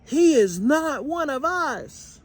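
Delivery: high, squeaky group yell; high-pitched protest; mites yelling. A narrator's reading. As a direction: shout it like tiny angry mites. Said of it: "the mites yelled".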